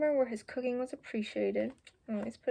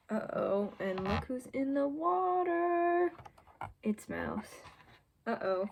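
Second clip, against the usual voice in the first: suspense; 3 semitones higher, slower than usual. Acted. Suspense reading to children.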